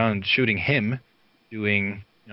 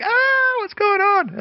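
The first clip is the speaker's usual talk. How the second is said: much higher and louder startled "what's going on?"; mock scream; 6 dB louder, slower than usual. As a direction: shriek it like frightened passers-by. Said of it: "They scream like".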